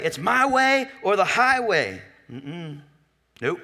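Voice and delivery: high, slow mock stubborn voice; playing the one who insists on his own way; stubborn know-it-all. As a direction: drag out a smug my-way line. Voicing a stubborn person.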